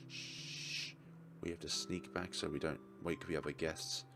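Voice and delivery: a whispered shh, then very soft to avoid waking guests; hushed sneaking. Acted. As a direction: keep it down, sneaking past sleeping guests. Sneaking back, not waking guests.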